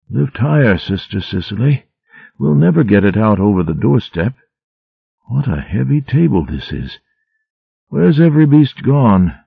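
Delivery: a hint of a feverish old mouse calling to a helper; delirious rambling. A narrator's reading. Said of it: "rambled on deliriously".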